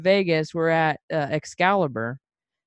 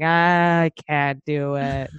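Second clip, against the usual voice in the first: put-on slow, drawn-out drunken whine; mock helpless moan, dragging every word; whiny drunk; 3 semitones lower, much slower than usual. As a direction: imitate a drunk giving up. Acts her drunk self refusing.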